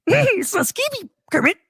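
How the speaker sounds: creepy clown calling down the sewer; Pennywise impression. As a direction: lure him in with a creepy high clown voice. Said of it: "Pennywise luring Kermit".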